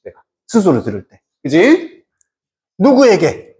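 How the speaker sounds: normal talking voice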